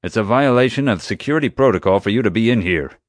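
stern security chief. A narrator's reading. Security chief finds a boy at the scene, hostile. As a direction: scold like a rule-bound security chief. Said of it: "He gave me a hot stare".

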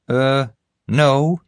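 high, eager, self-important voice; bumbling guard. Acted. Guard recounting the chase to the group, eagerly. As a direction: pitch it up and boast through the story.

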